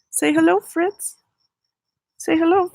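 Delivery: high pet-talk voice; cat-talk coaxing. Real, not acted. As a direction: coo at the cat to say hello.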